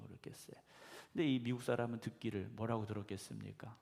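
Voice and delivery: ordinary talk, nothing marked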